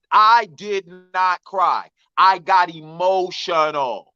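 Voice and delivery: slow, stretched "I did not cry", then "emotional" syllable by syllable; emphatic denial. Real, not acted. Man insisting on his version, emphatic denial.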